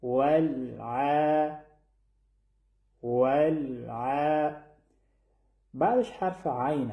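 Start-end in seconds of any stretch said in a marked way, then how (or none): 0.0-4.6 ولع chanted very slowly with a held, unbounced L; slow tajweed chant of ولع; still, unbounced lam. Shown as a demonstration. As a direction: hold the lam in ولع steady, then stretch the ayn vowel. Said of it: "اندکی هم تکان نباید بخورن".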